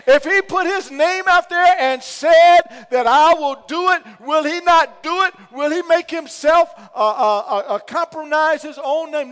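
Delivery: high, loud sermon delivery; preacher in full flight; preaching at full force. Real, not acted. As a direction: drive it home to the church.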